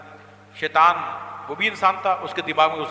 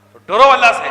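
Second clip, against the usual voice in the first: loud preacher's cry of fear God; shouted command; 6 semitones higher, 12 dB louder than usual. Real, not acted. Preacher commanding the congregation to fear God, full shout. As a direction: bellow the command at the crowd.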